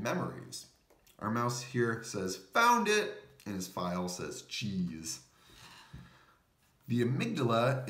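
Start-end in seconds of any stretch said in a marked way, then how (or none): 2.5-3.4 high, loud cry of discovery; mouse's loud, high cheer of discovery; excited mouse. Acted. Mouse finds the memory file. 4.4-5.2 low, softer, odd little voice; the file's lower, softer reply; talking file. Acted. File answers the mouse.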